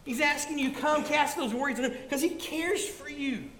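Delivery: rising preacher's exhortation; preaching. Acted. Pastor urging his flock, building preaching. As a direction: press the promise on the congregation.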